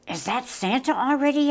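high, hopeful question; excited question. A narrator's reading. Cub hears chimney rumble, excited question.

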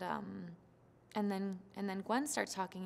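ordinary talk, nothing marked

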